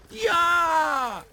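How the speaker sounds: high, loud playful roar; mice roar. Acted. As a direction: leap out and roar. Mice jump out scaring.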